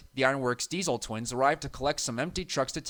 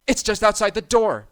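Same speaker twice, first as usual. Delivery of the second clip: high, loud frightened yelp; 8 semitones higher, 9 dB louder than usual. A narrator's reading. Thomas as the monster reaches his door, frightened yelp. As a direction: blurt it out in fright. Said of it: "Thomas yelped".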